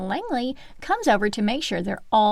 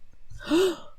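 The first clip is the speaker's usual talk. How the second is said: mock gasp; 7 semitones higher, 4 dB quieter than usual. Suspense gasp.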